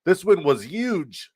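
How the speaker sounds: high, slow politician impression; Donald Trump. Streamer celebrating the duck win, cringey Trump impression. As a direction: stretch it out in a hammy presidential impersonation. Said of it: "my Donald Trump impression".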